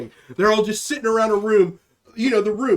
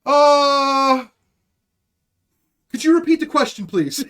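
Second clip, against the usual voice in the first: long, loud stalling "uh", then higher voice; hammed-up caught off guard; mock shocked; 5 semitones higher, 5 dB louder than usual. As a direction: play an official stumped by the question. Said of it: "they always look so shocked".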